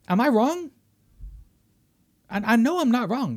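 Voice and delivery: loud, high-pitched exasperated rant; heated rant. Real, not acted. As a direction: demand answers, all fired up. Ranting at the studio's plans.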